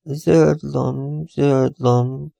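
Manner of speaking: high, dreamy rising repeat; musing. Acted. Receptionist musing over the villa name, high and dreamy. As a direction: let it drift, half lost in thought. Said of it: "mélázott az ifjú hölgy".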